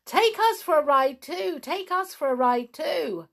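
high, loud, eager pixie clamour; shouting and calling. A narrator's reading. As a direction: call out excitedly, pitched right up. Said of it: "shouting and calling".

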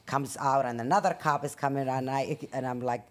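ordinary delivery

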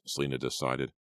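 usual speaking voice

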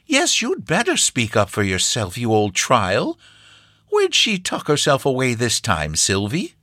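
put-on high old farm woman, slowing on "you old trial"; fond scold of the cow; high-pitched old country woman; grandmother scolding fondly. Grandmother scolds cow, greets Sylvia.

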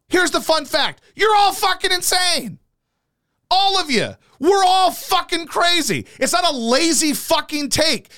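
real high-pitched angry rant. Real, not acted. Host rails at his social media followers, ranting. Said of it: "this house is not soundproof".

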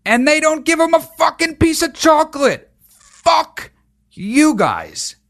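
high-pitched indignant outburst; outraged. Real, not acted. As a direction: rail at them, pitch shooting up. Outraged at pastor denying chocolate.

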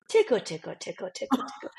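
lightly voiced toddler teasing; sing-song little tickle; playful tickle. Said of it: "he changes his face and he goes".

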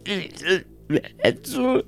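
high, halting creature voice; distressed creature. Acted. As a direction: call out for the master in a high, put-on voice. Game master voices freaking-out creature.

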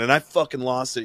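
ordinary talk, nothing marked

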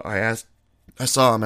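normal talking voice

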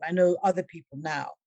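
normal talking voice

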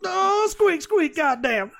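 high-pitched squeaky outburst; high squeaky mock. Mocking the squeaky wagon.

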